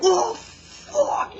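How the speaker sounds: very high, loud "Oh, fuck!" of disbelief; shocked outburst. Retold or imitated. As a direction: yelp it like you just saw the worst. Viewer reacting to the shock.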